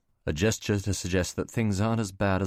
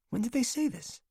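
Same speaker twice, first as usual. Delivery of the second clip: much higher, alarmed woman; alarmed woman's voice; 3 dB quieter than usual. Acted. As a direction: ask it in shock. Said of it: "Catherine voiced on phone".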